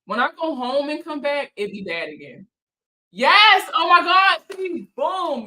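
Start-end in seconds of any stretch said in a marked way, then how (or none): 3.1-5.4 high, loud burst of triumph; excited cheer. Real, not acted. Cheering at the chat, loud and high. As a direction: crow with delight at the chat.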